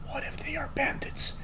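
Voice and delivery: hushed, suspicious whisper. A narrator's reading. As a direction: whisper a secret warning. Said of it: "he whispered".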